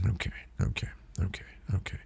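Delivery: hushed, unvoiced repeated muttering; whispered okays. Rider acknowledging the girl beside him, whispered. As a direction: whisper it to yourself, over and over. Muttering to himself after answering.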